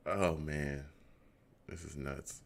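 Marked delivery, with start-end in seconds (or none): none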